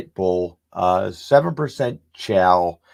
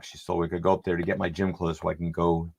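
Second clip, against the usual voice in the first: ordinary talk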